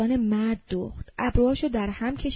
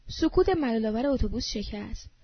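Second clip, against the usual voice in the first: plain reading voice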